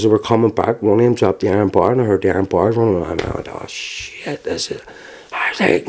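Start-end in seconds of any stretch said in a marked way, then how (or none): 3.5-4.8 hushed, breathy retold "oh shit"; dismayed under-breath curse. Victim recalling dread as the bars come, hushed. Retelling his dread as attackers return.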